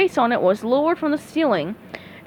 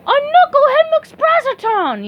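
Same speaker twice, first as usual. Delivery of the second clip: put-on high, loud, slow old lady yell; 6 dB louder than usual. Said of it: "yelled an old lady".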